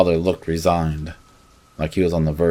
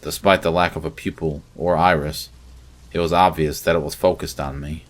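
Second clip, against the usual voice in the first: plain reading voice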